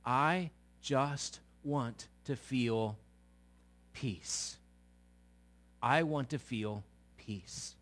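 low, soft and slow, worn out; a tired sigh of someone begging for peace; spent, pleading for calm; weary plea. Retold or imitated. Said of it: "We said".

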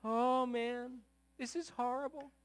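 high, tearful lament, acted out; mock crying. Preacher imitating the crying leader in the Bible story, mock tearful. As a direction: wail it lightly like a man feeling sorry for himself. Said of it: "And he's crying and".

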